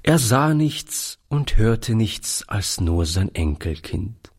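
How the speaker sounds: usual speaking voice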